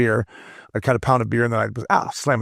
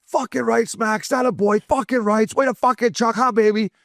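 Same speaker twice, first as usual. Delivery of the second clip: high-pitched cheer without full volume; retold ballpark holler, pitched way up; mock yelled cheering; 10 semitones higher than usual. Fan cheering on Max as he comes off, mock yelling. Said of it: "I had my hat off yelling".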